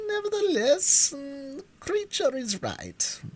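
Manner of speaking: pompous bug, lofty and drawn out; high, slow, learned insect; Wogglebug voice. A narrator's reading. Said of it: "declared the Wogglebug".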